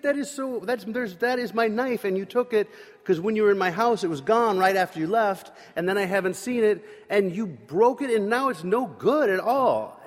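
high, loud, tumbling rant; upset accusation. Retold or imitated. Friend Scott loudly listing proof the knife was taken. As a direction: pile on the blame, voice rising and loud.

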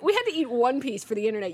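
ordinary talk, nothing marked

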